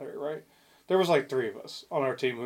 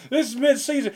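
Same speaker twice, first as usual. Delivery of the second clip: rip into the players; furious coach reminding them it's mid-season; angry coach shout; 8 semitones higher than usual. Retold or imitated. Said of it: "Dude, he ripped into us".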